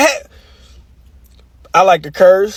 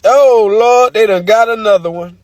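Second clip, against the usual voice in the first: ordinary delivery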